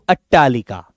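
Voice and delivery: अट्टालिका said low and crisp with a doubled ट्ट; Sanskrit word repeated. Shown as a demonstration. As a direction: drop low and say अट्टालिका clearly. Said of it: "Sanskrit में बोला जाता है".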